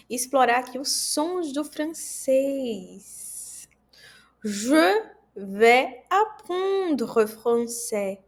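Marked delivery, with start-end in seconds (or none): 4.4-8.2 Je vais apprendre français, slow and clear; teacher demo of the French phrase; slow French model sentence. Shown as a demonstration. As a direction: model the French line slowly. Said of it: "Repita comigo".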